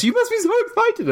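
squeaky gushing friend voice; high mock gushing. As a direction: gush it in a silly high voice. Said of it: "everyone is telling us".

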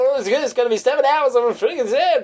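high mock radio DJ traffic rant; Adam Carolla impression. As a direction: rattle it off like a shock-jock traffic report. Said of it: "stealing Adam Carolla's lightning round routine".

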